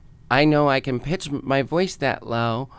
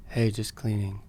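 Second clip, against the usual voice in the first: lower, softer straight-guy voice; 4 semitones lower, 8 dB quieter than usual. Retold or imitated. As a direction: butch it up, low and flat. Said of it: "it probably would have sounded like".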